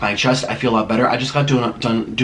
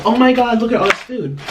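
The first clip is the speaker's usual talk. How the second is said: normal talking voice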